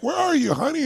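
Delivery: high, sing-song call across the house; calling out for partner. Retold or imitated. Husband calling for wife on coming home, sing-song call. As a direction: call out as if looking for her.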